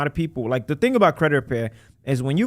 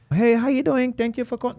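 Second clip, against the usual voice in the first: higher, lightly voiced overseas call-center greeting; a hint of a Filipino agent answering the phone; imitated foreign agent; 11 semitones higher than usual. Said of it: "when they hear an accent".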